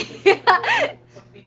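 loud squealing laugh, way up high; high shrieking laugh. Real, not acted. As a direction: burst into a shrill squeal of laughter. Laughs after bark.

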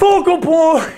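high, strained mock wrestler cry; short hammed-up holler; catchphrase impression. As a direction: toss it back in the put-on big-guy voice. Echoes his catchphrase in voice.

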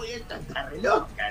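soft aside under the breath; muttered thought. Retold or imitated. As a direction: mutter it to yourself in shock. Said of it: "decía yo". (murmullo sorprendido para sí)

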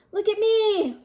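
high, proud little squeal; excited showing off. Acted. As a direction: call everyone to look, high and pleased. Pip shows off the fuzz.

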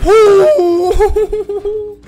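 a loud, high delighted squeal; excited high squeal. Real, not acted. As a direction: shriek with glee. Reacting to scene.

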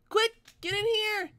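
ordinary delivery

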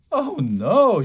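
high-pitched cry of dismay; alarmed shout. A narrator's reading. Annie spots danger for the beasts, shouting in alarm. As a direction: call out in alarm, pitch swooping up. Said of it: "shouted Annie".

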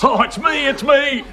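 high, eager claim; blurting it's me in greedy excitement; excited. Acted. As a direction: jump in before he finishes. Hears of inheritance.

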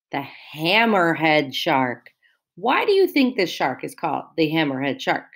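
normal talking voice

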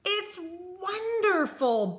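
high, drawn-out delighted chirp; high storybook bird voice, stretched out. A narrator's reading. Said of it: "Bird chirped".

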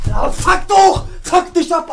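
slow, loud furious yell; angry shout. Real, not acted. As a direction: curse out loud in anger. Furious after foul.

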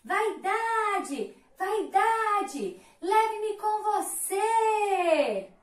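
high, slow sing-song plea with a tearful edge; pleading for help. Acted. Drowning Love begs Vanity's boat, tearful high plea. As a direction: call out, half singing, half crying. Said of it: "o barco da vaidade".

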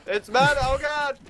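high panicked yelps. Real, not acted. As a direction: squeal as it chases you. Enemy chasing him.

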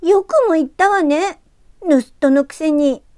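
high, loud outraged retort; furious girl. Acted. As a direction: lash out, stung and furious. ユキが激怒して言い返す. (激怒する少女の声)

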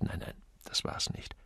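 soft whispered dismissal; whispered aside. A narrator's reading. Peter ruling out drugs to himself, whispered. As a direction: breathe it out under your voice.

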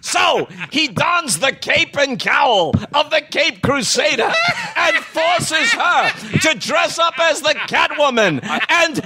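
high, booming sportscaster delivery; Howard Cosell. Acted. Comic telling his Batman and Catwoman joke layer, in sportscaster bombast. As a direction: declaim it like a grandiose sportscaster. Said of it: "falling into a Howard Cosell".

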